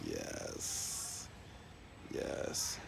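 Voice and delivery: long, hushed, drawn-out yes; gloating whisper. Acted. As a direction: let the word out slowly on a breath. Savouring the stem cell treatment.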